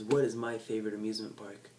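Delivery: normal talking voice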